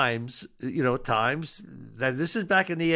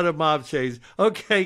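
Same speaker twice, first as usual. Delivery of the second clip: ordinary talk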